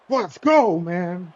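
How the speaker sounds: loud, high victory yell; excited shout. Real, not acted. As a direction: shout it out in triumph. Celebrating a win, shouting.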